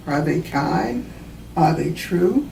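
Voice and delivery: usual speaking voice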